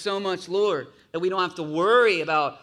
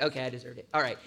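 resigned; 7 semitones lower, 4 dB quieter, much faster than usual. Retold or imitated. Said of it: "you're like".

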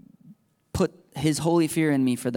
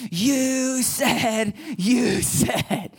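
put-on whining child; 9 semitones higher, much slower than usual. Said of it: "and they're like".

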